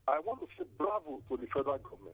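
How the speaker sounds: faint phone line, soft and far off; almost lost on a weak connection; barely audible. Real, not acted. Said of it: "Divine, your voice is very faint".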